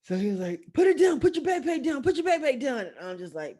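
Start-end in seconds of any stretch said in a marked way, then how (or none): 0.7-2.9 high, loud, urgent imitation of a robber; shouted order. Storyteller imitates robber ordering backpack down, shouting. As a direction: yell it as a repeated command.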